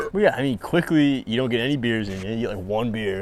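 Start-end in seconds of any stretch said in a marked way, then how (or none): none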